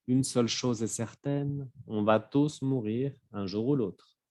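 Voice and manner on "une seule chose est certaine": ordinary delivery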